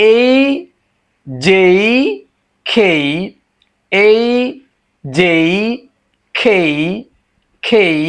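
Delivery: "এই", "যেই", "খেই" each stretched with a glide to i; emphatic, separated models of "এই", "যেই", "খেই"; letter pronunciation drill. Shown as a demonstration. Teacher drilling three letters for learners, emphatic pronunciation drill. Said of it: "সেটা উচ্চারণ হবে".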